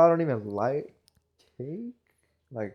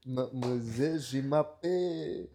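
put-on terrible French accent; 5 semitones higher than usual. Groom hams up bad French for the podcast, hammed-up French accent. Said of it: "very terrible French accent".

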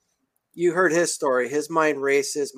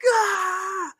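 high-pitched held "aah" scream; excited inner scream. Acted. Stoked man voicing his racing mind, excited high scream. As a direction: let out a long, high squeal of a yell. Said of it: "in my mind, like".

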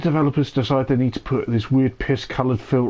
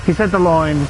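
excited shout; 5 semitones higher than usual. Real, not acted. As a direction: cheer like a fan. Celebrating the line.

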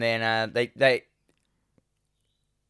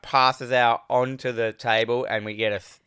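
normal talking voice

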